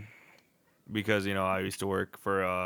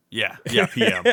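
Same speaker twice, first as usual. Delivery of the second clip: laughing; 10 semitones higher, 9 dB louder than usual. Real, not acted. Laughing correction of time.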